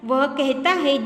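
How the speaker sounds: ordinary talk, nothing marked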